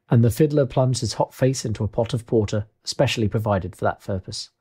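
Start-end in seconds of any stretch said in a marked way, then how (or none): none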